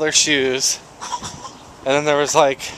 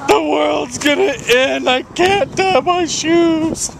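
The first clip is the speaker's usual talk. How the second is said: high, whiny mock kid wail; 11 semitones higher than usual. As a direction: whine like a melodramatic child. Mimicking crying kids.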